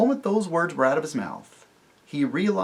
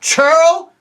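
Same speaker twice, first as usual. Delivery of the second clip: much higher, loud outraged cry; indignant cry; 13 dB louder than usual. Acted. Tirian defends Jill, crying out in outrage. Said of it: "cried Tirian".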